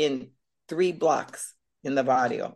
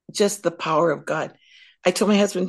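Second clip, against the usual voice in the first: normal talking voice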